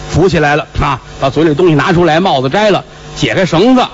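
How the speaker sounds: normal talking voice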